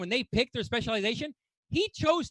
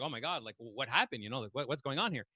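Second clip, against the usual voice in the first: much lower, much softer, fast worried murmur; worried quoted reply; 12 semitones lower, 11 dB quieter than usual. Retold or imitated. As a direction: mutter it like a nervous patient. His own worried reply, quoted.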